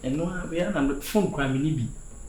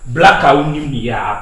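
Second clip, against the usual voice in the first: loud insult; 9 dB louder than usual. Acted. Repeats the insult, then softer.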